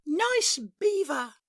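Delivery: high, slow, pleased beaver; a beaver accepting a compliment. Acted. Beaver replies to the customer, high and slow.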